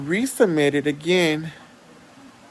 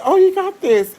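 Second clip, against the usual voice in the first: high, loud cheering-on; pep someone up in a high imitated voice; retold encouragement; 14 semitones higher, 7 dB louder than usual. Clerk encouraging a nervous customer, high and loud.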